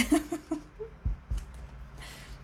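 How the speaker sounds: airy rising giggle; high breathy giggle. Real, not acted. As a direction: giggle softly under breath. Laughs after "fazla gülme.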